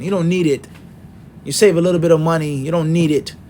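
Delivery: ordinary talk, nothing marked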